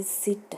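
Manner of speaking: सिट with a short clipped i. Shown as a demonstration. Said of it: "छोटी sound".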